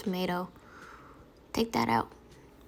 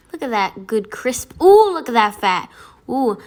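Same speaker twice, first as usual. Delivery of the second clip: normal talking voice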